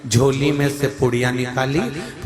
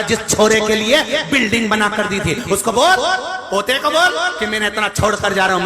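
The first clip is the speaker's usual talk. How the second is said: fiery preaching; 7 semitones higher than usual. Acted. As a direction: preach it hard to the crowd. Preaching at full force. (जोशीला प्रवचन, ऊँची और ज़ोरदार आवाज़)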